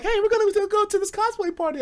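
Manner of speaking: high, bright mock girl voice; lightly voiced girl; girls' voice. Girls inviting him to the party, mock high voice.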